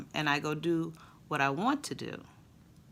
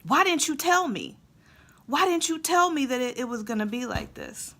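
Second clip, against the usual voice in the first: put-on much higher, whiny kid's rant; son ranting; 9 semitones higher, 4 dB louder than usual. Son ranting to mom on the porch, high whiny complaint. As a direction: whine it like a cold, fed-up boy. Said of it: "in the middle of his vent".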